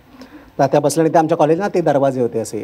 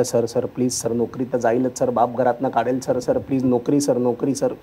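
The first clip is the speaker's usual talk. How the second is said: soft, whiny begging, lighter than his own voice; mock pleading; 5 semitones lower, 7 dB quieter, slower than usual. Students begging the professor for their jobs, soft whiny pleading. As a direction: grovel softly for mercy.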